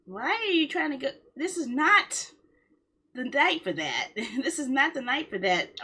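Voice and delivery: normal talking voice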